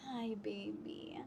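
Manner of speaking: soft, slow murmured greeting to a pet. Real, not acted. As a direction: hush it slowly like waking a sleeping cat. Cooing to sleepy cat.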